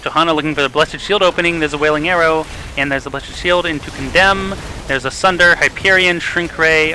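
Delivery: loud, fast, rising play-by-play call; excited play-by-play. Acted. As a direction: call the fight at full hype. Live team fight call.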